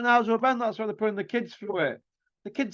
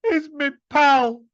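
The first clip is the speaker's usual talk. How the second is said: "He's me pal." said higher and louder, slowly; mock pal defence; 9 semitones higher, 6 dB louder, slower than usual. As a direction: whine it out like a defensive mate. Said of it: "who's the son of his pal".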